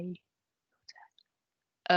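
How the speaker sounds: a soft whispered prompt to the class. Real, not acted. Teacher whispering the cue to her pupils. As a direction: breathe it, barely voiced.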